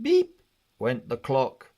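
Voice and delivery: plain reading voice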